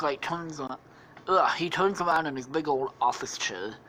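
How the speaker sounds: ordinary delivery, nothing marked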